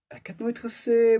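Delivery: high, loud protesting youth; protesting boy. Acted. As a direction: start defending yourself quickly. Luan starts to protest.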